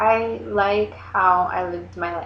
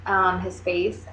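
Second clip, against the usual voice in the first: ordinary delivery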